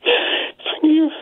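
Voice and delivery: choked up, barely getting words out; crying on the phone. Real, not acted. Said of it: "I'm beginning to break up".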